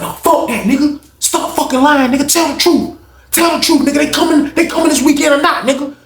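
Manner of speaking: high, heated demands; voicing an inmate interrogating, high and heated. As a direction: grill him for the truth. Reggie interrogating.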